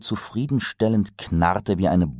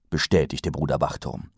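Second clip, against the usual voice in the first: usual speaking voice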